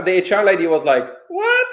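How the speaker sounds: usual speaking voice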